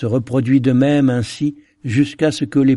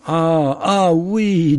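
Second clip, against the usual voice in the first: exclamation aiguë d'un homme qui perd la raison; un pilote fou, la voix qui monte haut; pilote devenu fou; 7 semitones higher, much slower than usual. A narrator's reading. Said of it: "alors devenu fou, rit fort". (le pilote devenu fou, voix haute et égarée)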